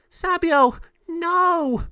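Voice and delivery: very high, loud cry of alarm. Acted. Girl seeing Sabio trapped, high alarmed cry. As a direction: cry out in shock.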